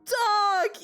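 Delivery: high, loud, slow words wheezed out through tears of laughter; helpless cry-laugh. Real, not acted. Streamer recalling the kitty meow meow reading, crying with laughter.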